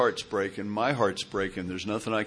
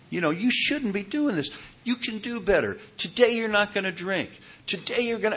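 coach-like pep talk to oneself, voice lifting higher; self pep talk; 7 semitones higher than usual. Retold or imitated. Pep talk to himself while driving.

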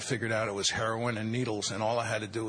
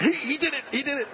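high, pointing voice of the wife; a lightly voiced wife telling on her husband; Nancy's mock accusation; 12 semitones higher, faster than usual. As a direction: tattle on him, high. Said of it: "And Nancy went".

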